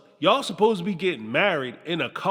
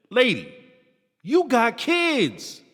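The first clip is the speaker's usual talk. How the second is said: scolding; 5 semitones higher, slower than usual. Pretend. Scolding Jimena.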